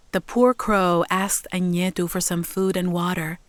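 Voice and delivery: ordinary delivery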